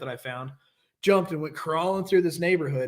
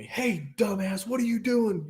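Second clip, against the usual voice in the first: higher, mock angry brother yelling; retold yelling brother; 6 semitones higher, slower than usual. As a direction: bark it like an annoyed older brother. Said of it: "Yelling at me".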